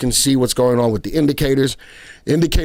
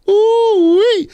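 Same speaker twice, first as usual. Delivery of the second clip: high whoop of laughter; laughing; 5 dB louder than usual. Real, not acted. Laughing at own French.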